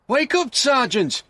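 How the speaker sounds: high, loud urgent wake-up call; shout. Acted. Constable shouting at the sleepy sergeant, high and loud. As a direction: yell to rouse a dozing sergeant. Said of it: "he shouted".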